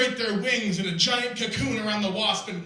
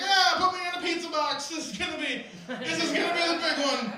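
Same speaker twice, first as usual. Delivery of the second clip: gung-ho pilot; 8 semitones higher, faster than usual. Retold or imitated.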